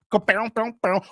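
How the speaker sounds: twanging strummed-string mouth noises; rhythmic mouth-guitar riff; imitated guitar. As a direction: mimic plucked strings vocally. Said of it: "I can strung a little bit of guitar".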